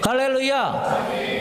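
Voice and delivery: slow stretched hallelujah; drawn-out hallelujah. Acted. Pastor stretching out a hallelujah to the church. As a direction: stretch it out in praise.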